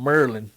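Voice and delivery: "Merlin" with the first syllable as "mur"; imitated local pronunciation. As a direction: playfully squash Maryland into "Merlin", like the wizard. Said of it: "they call it Merlin".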